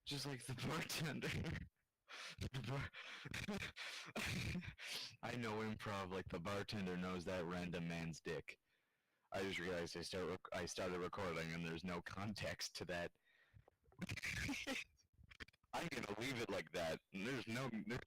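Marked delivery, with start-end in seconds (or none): 3.0-5.2 squeaky breathless giggle; high silent wheeze of laughter; high wheezing giggle. Real, not acted. Laughing at own joke. 14.0-14.8 squeaky breathless giggle; high silent wheeze of laughter; high wheezing giggle. Real, not acted. Laughing at own joke.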